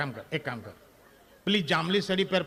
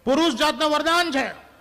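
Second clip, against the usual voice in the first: punchline shout; 8 semitones higher, 6 dB louder than usual. Real, not acted. Punchline climax to audience.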